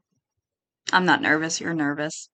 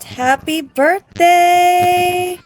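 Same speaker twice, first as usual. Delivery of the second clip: high, long-drawn celebratory call; birthday cheer; 9 semitones higher, 3 dB louder, much slower than usual. Real, not acted. Player celebrates the child's birthday, drawn-out cheer. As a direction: cheer it out slowly, pitch way up.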